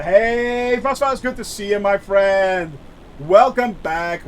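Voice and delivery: usual speaking voice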